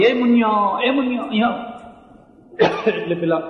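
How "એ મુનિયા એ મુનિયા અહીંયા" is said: put-on children calling to Muniya across the room, raised and high; retold calling out.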